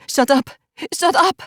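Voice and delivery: building to a high, anguished scream; agonized scream. Acted. To the cruel voice in her head, building to a scream. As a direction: let it break into an agonized scream. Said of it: "The final word leaves me on an agonized scream".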